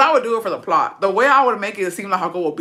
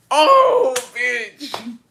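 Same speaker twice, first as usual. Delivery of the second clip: loud outburst; 5 semitones higher, 4 dB louder than usual. Real, not acted. Breaks from the apology cackling.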